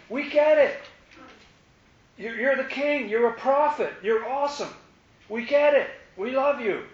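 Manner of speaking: excited crowd. Retold or imitated. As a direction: gush like adoring fans sure they get it. Crowd cheering, not understanding.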